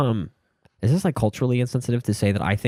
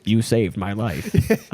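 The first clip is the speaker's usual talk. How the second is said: slow, drawn-out character impression; very slow mock gratitude; Marcus the Worm; 2 semitones lower, much slower than usual. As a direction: imitate a rescued little character, deliberate. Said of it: "then he's like".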